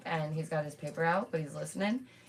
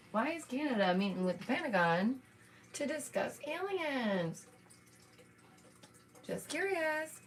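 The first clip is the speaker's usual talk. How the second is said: sarcastic insinuation; 7 semitones higher than usual. Real, not acted. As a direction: play innocent while hinting. Hinting at a cover-up.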